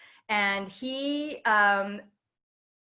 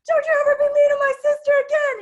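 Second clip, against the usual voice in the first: much higher, louder, speeding up at the end; furious cursing rant; 11 dB louder than usual. Retold or imitated. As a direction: rant nonstop at the girl, near tears with rage. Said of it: "he just kept going".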